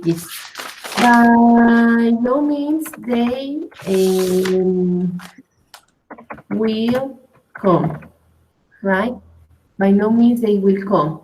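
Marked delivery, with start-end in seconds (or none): 1.0-8.0 very slow, word by word; slow example. Shown as a demonstration. Teacher gives a student a slow example sentence, slow and deliberate. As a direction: spell the example out slowly for the student. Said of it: "for example".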